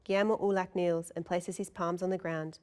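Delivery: usual speaking voice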